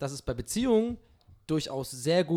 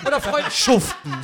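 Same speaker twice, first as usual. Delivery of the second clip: "Freundschaften" drawn out, higher, second half distorted into "schuften"; joke mis-stress; 7 semitones higher, 5 dB louder than usual. Acted. Mocking own slip, overdone stress on "Freundschaften". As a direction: overdo the end of "Freundschaften", breaking into laughter. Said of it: "ja, das falsch betont".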